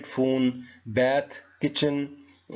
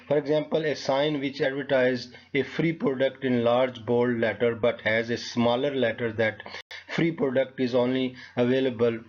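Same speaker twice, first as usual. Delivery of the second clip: normal talking voice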